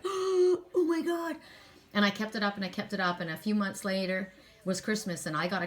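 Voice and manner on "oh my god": high, slow, stunned "oh my God"; shocked gasp. Retold or imitated. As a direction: coworkers gasping in disbelief as the rude woman says hello, drawn-out astonished cry. Coworkers gasping at her hello.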